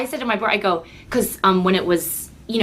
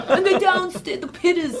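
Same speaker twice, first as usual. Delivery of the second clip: slow, higher mock old grandpa through a laugh; a lightly voiced old grandpa; 10 semitones higher than usual. Imitating her grandpa for the hosts, slow mock old man. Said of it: "so I do that voice too".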